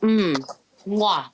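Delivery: munching right on the mic; chewing crisps. Real, not acted. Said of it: "Listen, listen to this".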